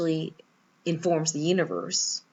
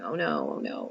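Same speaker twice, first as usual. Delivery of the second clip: low, sinking "oh no" twice; dismayed groan; 14 semitones lower, 5 dB quieter than usual. Retold or imitated. Staring at a scratch on a stranger's car, low dismayed groan. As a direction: let the heart drop at the scratch.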